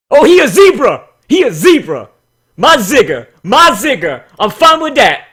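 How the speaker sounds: loud, high, delighted whoop; excited shout. Real, not acted. Reviewer thrilled at a zebra character, loud happy shout. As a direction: cheer like you just saw your favourite thing.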